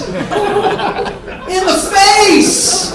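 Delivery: high, loud burst of disbelief; exclaiming in disbelief. Real, not acted. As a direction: hit it like you still can't believe it. Storyteller exclaims shock.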